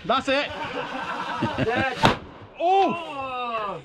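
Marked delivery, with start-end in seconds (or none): 0.5-2.2 high unvoiced giggle; breathy laugh. Real, not acted. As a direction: laugh out under your breath. Laughing after firefight.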